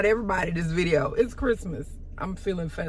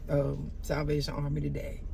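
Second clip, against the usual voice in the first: usual speaking voice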